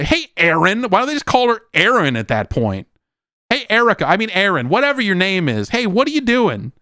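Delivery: high, chirpy fake greeting; mocking greeting. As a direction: parody the engineer's cheerful hello. Mock greetings using wrong name.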